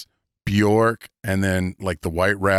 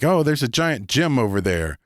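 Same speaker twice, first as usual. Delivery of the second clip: eager player; 8 semitones higher than usual. Retold or imitated.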